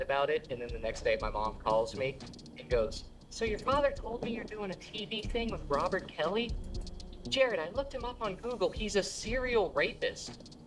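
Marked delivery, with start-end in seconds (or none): 3.3-10.6 high, mock-worried mom voice; a high, fretting mother. Comedian imitates his mom warning him.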